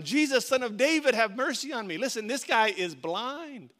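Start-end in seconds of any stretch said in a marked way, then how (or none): 0.0-2.0 louder, higher call for help; retold shout. Blind beggar calling to Jesus passing by, retold shout. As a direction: cry out over a crowd, moderately raised. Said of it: "So he started shouting".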